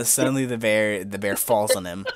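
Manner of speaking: ordinary talk, nothing marked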